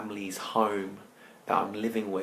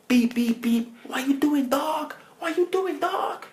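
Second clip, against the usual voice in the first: very high, loud heckling yell; mock gangster squawking from a car; American gangsters; 6 dB louder than usual. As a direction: honk and holler in a squeaky caricature. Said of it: "gangsters are like".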